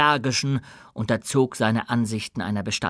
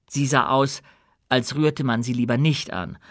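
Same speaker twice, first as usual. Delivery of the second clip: ordinary delivery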